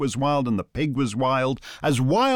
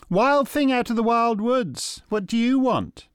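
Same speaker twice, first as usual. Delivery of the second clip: woman's voice; 11 semitones higher than usual. Acted. Woman addresses dog.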